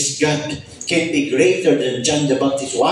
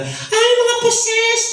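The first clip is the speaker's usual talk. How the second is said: mocking critic; much slower than usual. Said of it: "Sabi nila".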